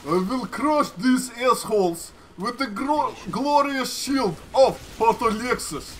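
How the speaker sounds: put-on ponderous Russian-accented battle vow. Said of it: "Do a Russian accent".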